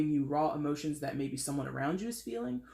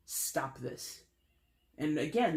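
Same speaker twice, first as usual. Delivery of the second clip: ordinary talk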